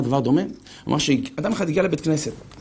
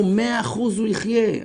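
confident promise; 7 semitones higher, slower than usual. Retold or imitated. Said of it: "והיו רבנים שאמרו".

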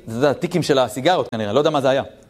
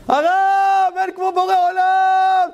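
much higher, louder joyful shout over party noise; ecstatic shout; 4 dB louder, slower than usual. Retold or imitated. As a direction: yell it out in rapture to the rabbi. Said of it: "צועק".

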